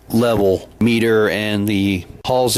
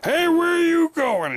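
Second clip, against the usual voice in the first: much higher playful call; mock call after him. Actor calls after the guest inching away, high teasing call. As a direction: sing out after the fleeing guest. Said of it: "I was like".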